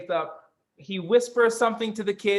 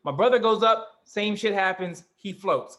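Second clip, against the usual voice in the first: normal talking voice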